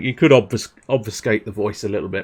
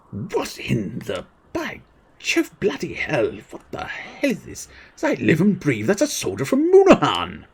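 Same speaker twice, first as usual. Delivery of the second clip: high, halting voice of disbelief; astonished character; 7 semitones higher than usual. Acted. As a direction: gasp it out, building to alarm.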